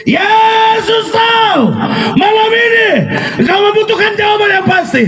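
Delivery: high, sustained preacher's shout; full-force preaching. Real, not acted. Preacher promising the congregation an answer tonight, shouting at full force. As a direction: proclaim it with long-held, high words.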